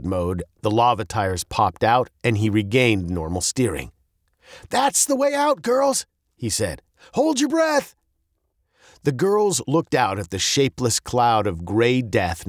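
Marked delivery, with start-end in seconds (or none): 4.7-6.1 high, excited announcement; urgent excitement. A narrator's reading. Dad spots the tunnel for girls, high excitement. 7.1-7.9 high, urgent command; urgent warning. A narrator's reading. Dad readies girls for the tunnel, high and urgent.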